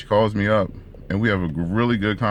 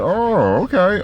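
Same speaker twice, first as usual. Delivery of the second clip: high, louder drawn-out reaction; mock surprised realisation; 13 semitones higher, 5 dB louder, much slower than usual. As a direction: act out a sudden "oh, I see". Reacting to her surprise question.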